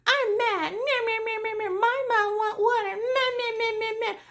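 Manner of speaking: high-pitched mocking whine, nagging nonstop; whiny family member. Nurse mocks demanding family members at the bedside, high whiny mimicry. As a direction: mimic a complainer in a high, bleating voice. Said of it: "some family members that's just like".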